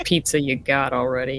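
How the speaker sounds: ordinary talk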